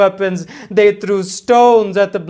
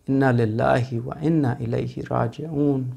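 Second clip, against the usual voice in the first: "Inna lillahi wa inna ilayhi raji'un" murmured much softer and lower; hushed recitation; 6 semitones lower, 8 dB quieter than usual. Real, not acted. Preacher repeating the verse in a hushed mourning tone. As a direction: sink into a grieving hush on "Inna lillahi". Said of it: "second recitation, hushed".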